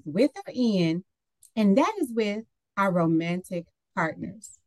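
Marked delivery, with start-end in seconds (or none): none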